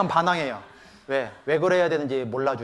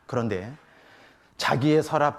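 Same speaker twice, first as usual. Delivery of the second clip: ordinary delivery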